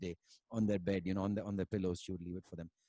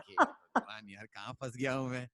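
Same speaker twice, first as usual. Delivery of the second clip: laughing mock despair. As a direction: laugh through a mock cry of being trapped. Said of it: "I'm like".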